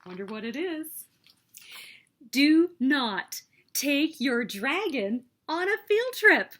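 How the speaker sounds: ordinary delivery, nothing marked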